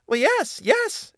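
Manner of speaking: very high, squeaky mouse voice; agreeable mouse. Acted. Mouse agreeing to let the cat go, in a very high voice. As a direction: pipe up eagerly as a tiny mouse.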